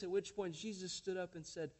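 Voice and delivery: usual speaking voice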